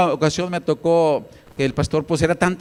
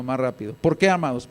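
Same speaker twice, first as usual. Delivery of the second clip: normal talking voice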